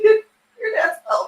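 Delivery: real laughing jab; laughing insult. Real, not acted. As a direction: tease chat while cracking up. Laughing at chat joke.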